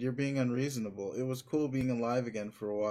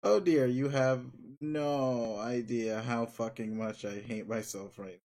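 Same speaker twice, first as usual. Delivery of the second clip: ordinary talk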